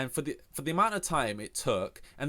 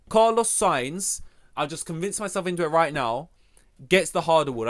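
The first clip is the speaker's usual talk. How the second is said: award announcement; 4 semitones higher, 6 dB louder than usual. Pretend. Announcing his own award.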